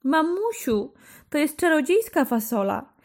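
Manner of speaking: put-on high, eager child's voice; a boy calling out full of hope; boyish, hopeful cry to his mother. A narrator's reading. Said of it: "zawołał Jaś pełen nadziei".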